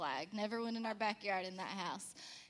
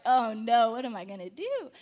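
mock panic; 11 dB louder than usual. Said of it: "So then I was like".

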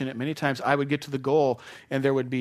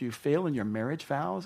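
ordinary talk

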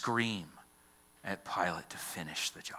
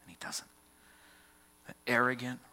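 ordinary talk

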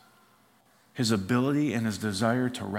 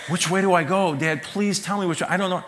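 anxious son; 6 semitones higher, 4 dB louder than usual. Acted. Son panicking over directions.

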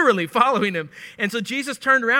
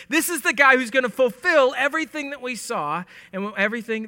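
fervent proclamation; 5 semitones higher than usual. Retold or imitated. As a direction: announce the Messiah with rising conviction. John proclaims Jesus as Messiah.

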